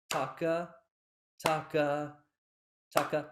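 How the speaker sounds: taka drawn out slightly; "taka" chanted at full length; longer take. Shown as a demonstration. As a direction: let each "taka" fill its beat.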